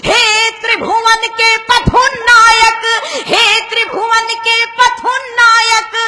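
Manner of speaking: high, singsong declamation; patriotic poem recitation. Acted. Recites verse.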